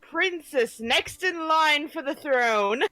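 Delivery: herald announcing. Pretend. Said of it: "could you do a little bit of announcing".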